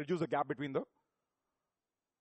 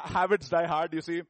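laughing; 5 dB louder than usual. Real, not acted. Preacher laughing at his own slip about the lab. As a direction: laugh through the words.

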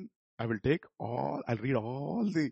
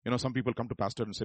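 normal talking voice

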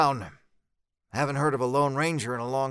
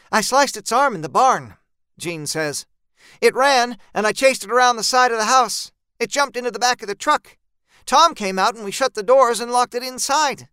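higher, louder telling of the chase; Jean hurrying through the story, raised voice; Jean recounting the chase, higher and louder; Jean voiced higher and louder; 8 semitones higher, 6 dB louder than usual. A narrator's reading.

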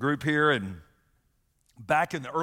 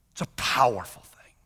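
intense whisper; 2 semitones lower, 3 dB louder than usual. Real, not acted. Pastor stressing forgiveness's power, whispering. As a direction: breathe it out as a secret. Hushed emphasis.

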